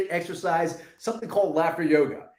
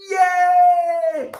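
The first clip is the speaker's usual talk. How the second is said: high, louder shout of joy; joyful yay shout; 11 semitones higher, 9 dB louder than usual. Shown as a demonstration. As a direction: cheer it out. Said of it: "And say".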